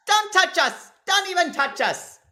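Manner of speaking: very high, wailing villain being dragged off; hammed-up squeals, stretching out the last cry; mock protest. Mother thrown out of the house, high melodramatic mock protest.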